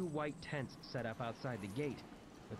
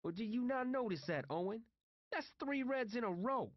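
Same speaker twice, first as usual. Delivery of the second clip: high, insistent rebuttal; heated retort; 6 semitones higher than usual. A narrator's reading. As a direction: argue back in a much higher voice. T J argues back at Owen.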